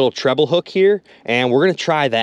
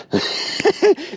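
high surprised laugh; 14 semitones higher than usual. Real, not acted. Surprised to mark a fish.

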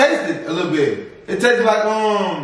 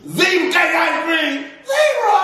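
excited shout; 12 semitones higher, slower than usual. Real, not acted. Excited shout over ice cream.

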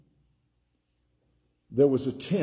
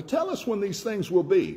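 disciples asking; 8 semitones higher than usual. Acted. Disciples asking Jesus in scripture reading.

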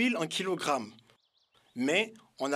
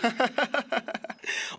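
merchant's amused chuckle; 7 semitones higher than usual. Acted. Merchant laughs at child.

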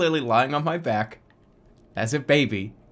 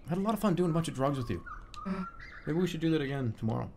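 quiet; 10 dB quieter, much faster than usual. Acted. Quiet fast joking aside.

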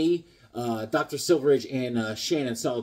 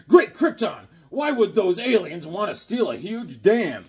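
comic hero voice; 4 semitones higher, 5 dB louder than usual. Pretend. Reads a comic panel aloud.

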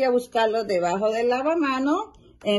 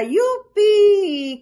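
high, loud joyful yelp; excited cheer; 9 semitones higher, 7 dB louder than usual. Acted. Little frog cheering at the morning, high and loud. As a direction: burst out with a child's delight. Froggy shouts seeing butterflies.

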